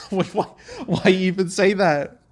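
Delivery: high, breathy laugh breaking into the words; giggling at how dumb the line was; laughing disbelief. Real, not acted. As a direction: laugh through a baffled question.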